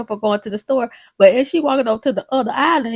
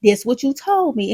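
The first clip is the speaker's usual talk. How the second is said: usual speaking voice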